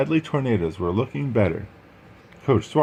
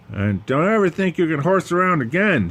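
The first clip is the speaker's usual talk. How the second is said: higher, firm threat to the team; stern coach warning; 8 semitones higher, 3 dB louder than usual. Acted. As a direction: warn them never to horse around.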